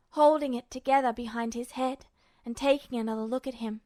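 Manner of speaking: ordinary delivery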